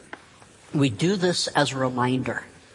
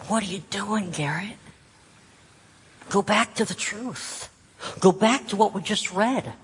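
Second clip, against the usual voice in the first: higher-pitched, questioning then urging voice of God; lightly voiced God, raised pitch; God calling back; 7 semitones higher than usual. God calls the doubting preacher back to the truth, lightly voiced, urging. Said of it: "And God goes".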